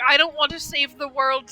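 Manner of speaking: ordinary delivery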